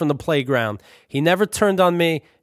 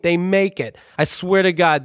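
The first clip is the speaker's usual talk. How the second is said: plain reading voice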